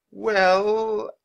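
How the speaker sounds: high, long drawn-out hint; loud, lingering high note; voice going up. A narrator's reading. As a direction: stretch it out high and suggestive. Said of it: "Her voice went up".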